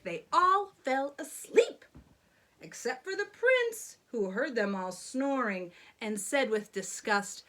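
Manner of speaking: usual speaking voice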